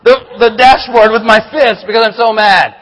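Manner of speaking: heated telling of the car rage; reliving the anger. Retold or imitated. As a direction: let the anger flare as you tell it. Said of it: "I'm just so angry".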